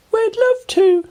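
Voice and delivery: put-on a bright little girl introducing herself; Ruby smiling. A narrator's reading. Ruby greeting Oliver, very high cheerful girl voice. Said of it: "said Ruby".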